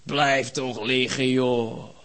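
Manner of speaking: slow, drawn-out, low coaxing; languid, dragged-out invitation to stay; mock bed's plea. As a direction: stretch each word out lazily, low and lingering. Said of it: "En mijn bed schreeuwt".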